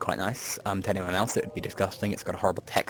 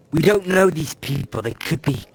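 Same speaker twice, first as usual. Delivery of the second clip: slimy voice; 4 semitones higher, 6 dB louder than usual. Acted. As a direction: warn them in a slimy, insinuating voice. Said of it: "slimy voice coming from".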